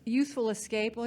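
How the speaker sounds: ordinary talk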